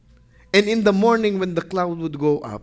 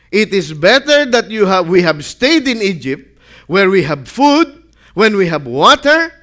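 loud, whining protest of a hungry crowd; complaining Israelites; 3 semitones higher, 7 dB louder than usual. Acted. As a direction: grumble it out like people fed up in the desert. Voicing the grumbling Israelites.

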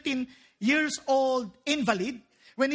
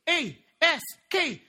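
ordinary talk, nothing marked

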